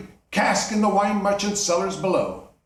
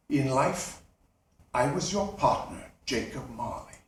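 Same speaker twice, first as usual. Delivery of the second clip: low, much softer ghostly answer; solemn ghost; 5 semitones lower, 5 dB quieter than usual. Acted. Marley's ghost answering Scrooge, low and hushed. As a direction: answer from the grave, hushed and heavy.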